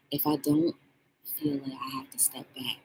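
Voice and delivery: whispered self-reminder. Shown as a demonstration. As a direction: murmur it under your breath. Whispered repeat of own line.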